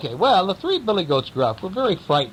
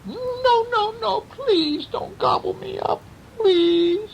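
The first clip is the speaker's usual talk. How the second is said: much higher, crying little goat begging; tiny pleading voice; slower than usual. Acted. Littlest goat begs the troll not to eat him, high and tearful. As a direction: squeal a tearful plea. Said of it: "in a tiny voice".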